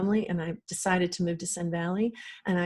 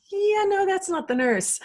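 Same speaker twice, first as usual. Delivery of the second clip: retold disbelief, pitched up high; doubtful objection; 12 semitones higher, 4 dB louder than usual. Patient telling doctor it's the wrong nurse, doubtful objection.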